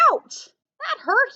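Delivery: a lightly voiced yelp of pain; Mouse crying out. Mouse pricked by thorns, mild yelp. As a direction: cry out, pricked by thorns, high. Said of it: "he cried".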